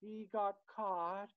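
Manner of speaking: put-on high, slow little girl; drawn out, lightly childlike; niece's voice. Uncle mimicking niece's recording, high and slow. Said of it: "another voice goes".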